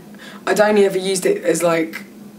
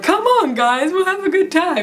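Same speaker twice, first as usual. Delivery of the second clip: much higher, slow pleading "come on guys"; cheery rallying call; 11 semitones higher, slower than usual. Retold or imitated. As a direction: coax them along brightly and slowly. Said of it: "I'm like".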